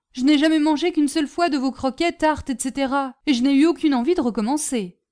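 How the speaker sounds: high, loud, quick denial; protesting boy, rattled off fast; indignant schoolboy. Acted. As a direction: refuse the charge hotly.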